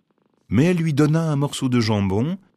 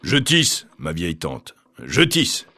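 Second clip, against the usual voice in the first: gruff cat voice; 7 semitones lower, slower than usual. A narrator's reading. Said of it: "répondit le chat d'une grosse voix".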